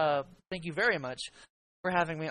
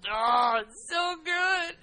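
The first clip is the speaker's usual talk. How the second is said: gushing; 9 semitones higher, much slower than usual. Real, not acted. Gushing over a listener email.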